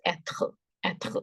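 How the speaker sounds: être as "et-khuh", with a throaty r; French pronunciation of être. Shown as a demonstration. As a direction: say être with a soft t and guttural r. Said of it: "So the sound is".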